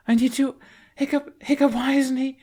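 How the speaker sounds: words tumbling out in panic; frantic. Acted. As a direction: Astrid begging Hiccup for answers. Astrid frantic over her dragon.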